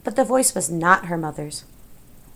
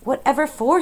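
higher, mildly annoyed question; 6 semitones higher, 4 dB louder than usual. A narrator's reading. Princess unused to being told what to do, annoyed. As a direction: answer with a touch of annoyance. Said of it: "in an annoyed tone".

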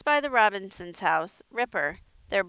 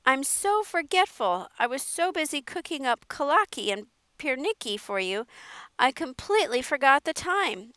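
higher, fussing aunt voice, all in a flurry; an apologetic aunt in a tizzy, pitched up; flustered old aunt; 7 semitones higher, 4 dB louder than usual. Acted. Aunt Edith, flustered at her door, greeting Mara in a higher fussing voice.

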